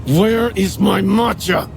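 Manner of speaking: slow, high, barely held rage; gritted teeth. A narrator's reading. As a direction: grind it out. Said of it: "gritting his teeth".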